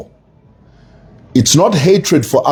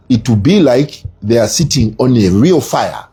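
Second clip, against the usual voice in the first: put-on calm, measured Ugandan-accented threat. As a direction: imitate Idi Amin's calm Ugandan accent. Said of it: "in his Ugandan accent, he said".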